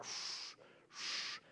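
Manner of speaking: soft, airy swooshes, one then another; fishtailing whoosh. Retold or imitated. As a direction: imitate a plane sliding side to side. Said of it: "we start fishtailing".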